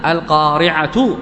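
القارعة drawn out slowly and ending in a voweled tu; linking t. Shown as a demonstration. Teacher showing the joined form, القارعة ending in a clear tu. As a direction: pronounce القارعة as al-qari'atu, ready to link on. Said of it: "وإذا أوصلتها فإنها تنطقها بالتاء".

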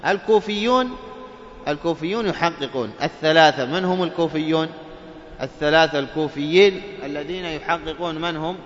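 normal talking voice

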